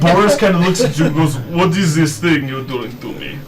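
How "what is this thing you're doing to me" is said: put-on slow, heavy Russian accent; menacing Russian accent, drawn out. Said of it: "Not the Russian accent".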